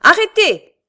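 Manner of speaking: very high, very loud yell; furious shout. A narrator's reading. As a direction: shout to stop them dead. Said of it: "cria-t-il en leur montrant le poing". (cri de rage, très fort)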